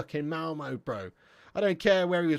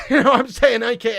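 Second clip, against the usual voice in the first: laughing loudly; 8 semitones higher, 13 dB louder than usual. Real, not acted. Laughing at his own joke.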